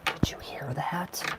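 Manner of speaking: slow hushed whisper. Real, not acted. Tarot reader hushing to her listener over the shuffle sound, whispering slowly. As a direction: whisper it slowly, as if the cards might hear.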